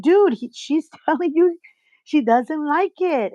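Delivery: mildly fed up, pleading his case; retold upset. Telling best friend to lay off his wife, mildly exasperated. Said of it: "I was getting so upset".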